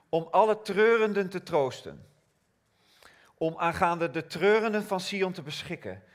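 ringing scripture proclamation; preaching. Acted. Reads Isaiah proclaiming.

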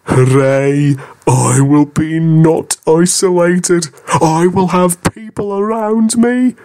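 excited engine. Acted. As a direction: cheer like an overjoyed engine. James cheers about getting friends.

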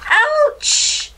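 very high, loud ouch; sharp pained yelp. A narrator's reading. Mouse who sat on a thistle, high sudden yelp of pain.

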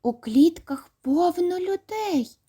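put-on high, slow astonished boy; amazed child. A narrator's reading. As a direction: gasp it out in wonder. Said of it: "з дива роззявив рота". (здивовано, високо й повільно)

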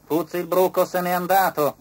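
inspector's voice. A narrator's reading. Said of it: "disse l'ispettore Formicone".

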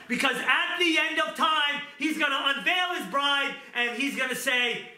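high, impassioned preaching; preaching at full force. Acted. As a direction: drive the point home.

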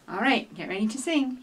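plain reading voice

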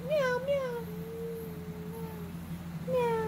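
high, soft playful meow; cat meow. Pretend. Parent showing a toddler how, playful cat meow. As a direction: mew like a little cat. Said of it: "meow like a cat".

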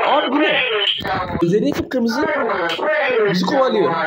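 panicked high yelps; frantic, voice jumping up in panic; being chased. Real, not acted. Baldi chasing, panicked. (kovalanırken panik içinde)

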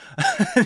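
high squeaking laugh; squeaky laugh. Real, not acted. Laugh before quote.

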